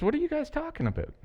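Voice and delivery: quick, high, light casual question; casual Jesus quote. Retold or imitated. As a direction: toss it off like a friendly passerby. Jesus voiced casually, sped up.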